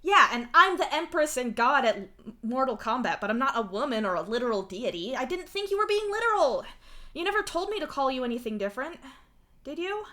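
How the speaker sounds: loud, high, panicky protest; rising fear. A narrator's reading. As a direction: argue loudly, then end on an alarmed question. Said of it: "you ask as fear fills you".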